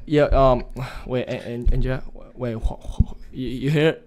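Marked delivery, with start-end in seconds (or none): none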